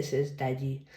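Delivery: ordinary delivery, nothing marked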